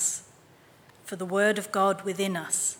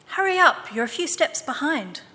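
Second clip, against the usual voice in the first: teasing call; 7 semitones higher, 4 dB louder than usual. A narrator's reading. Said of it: "Mama replied".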